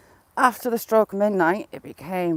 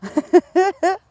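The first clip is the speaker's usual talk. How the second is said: high, loud burst of laughter; big laugh; 10 semitones higher, 8 dB louder than usual. Real, not acted. Laughing hard at funny memory.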